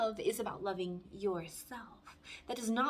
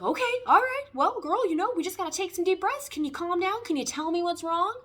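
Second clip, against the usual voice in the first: higher and louder, soothing dad talking her down; a father coaxing his panicking daughter to breathe, loud and high; imitating dad calming; 8 semitones higher, 9 dB louder than usual. Daughter voicing her dad calming her panic, higher and louder. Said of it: "and he's like".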